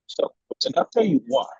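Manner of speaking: ordinary talk, nothing marked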